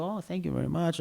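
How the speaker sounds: high-pitched, polite gush to a guest; gushing thanks. Retold or imitated. Producer thanking show guests, gushing.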